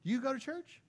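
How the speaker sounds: light up like you've been searching for this; eager neighbor. Retold or imitated. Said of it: "And they go".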